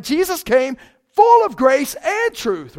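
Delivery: slow, loud, high proclamation; booming preacher's cry; preaching shout. Real, not acted. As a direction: proclaim it at full force, slowly.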